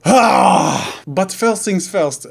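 put-on a light, slow hammy French accent. Passepartout restarts his intro, in a hammy French accent. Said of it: "lose the terrible French accent".

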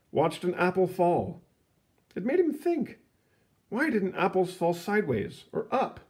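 lively read-aloud storybook voice; story narration. A narrator's reading. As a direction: read it like a picture book to kids.

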